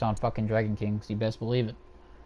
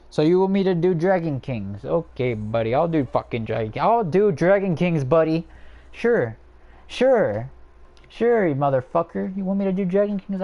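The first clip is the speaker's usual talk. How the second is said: loud, higher-pitched sarcastic compliance; exasperated agreement; 5 semitones higher, 4 dB louder than usual. Real, not acted. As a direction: give in loudly, fed up with being asked. Irked mock agreement to a viewer's request.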